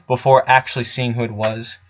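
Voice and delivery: ordinary delivery, nothing marked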